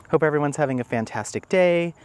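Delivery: normal talking voice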